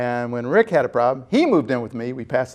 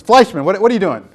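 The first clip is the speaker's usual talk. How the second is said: higher, louder mock scolding; gruff editor's challenge; 7 semitones higher, 10 dB louder than usual. Editor Tom McEwen confronting a young writer, mock gruff. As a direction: bark it like a tough editor. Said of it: "McEwen says".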